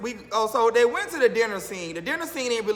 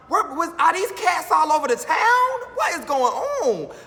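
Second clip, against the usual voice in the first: alarmed shout; 9 semitones higher, 5 dB louder than usual. Real, not acted. Alarmed at cats everywhere.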